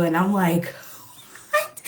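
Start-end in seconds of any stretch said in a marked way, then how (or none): 1.5-1.8 high, squeaked disbelief; a sharp, high yelp of disbelief; shocked what. Retold or imitated. To her boyfriend calling her fat: high shocked disbelief.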